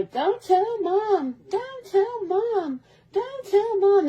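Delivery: put-on high, slow begging kid voice; pleading little brother. Brother begging his sister not to tell mom, high and slow. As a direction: drag it out high like a scared boy.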